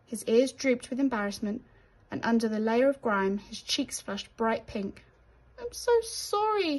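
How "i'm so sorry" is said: high slow squeak of an apology; embarrassed mouse squeaking sorry. Acted. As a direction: squeak it out, small and ashamed. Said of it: "he squeaked".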